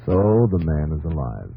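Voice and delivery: usual speaking voice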